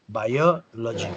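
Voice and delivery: biology said slowly, bio then logy; slow split syllables. Shown as a demonstration. Teacher modelling biology slowly for a student. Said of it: "y luego logy".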